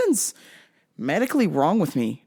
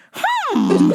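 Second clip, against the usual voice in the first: high suspicious hum; 6 dB louder than usual. Acted.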